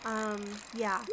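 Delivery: normal talking voice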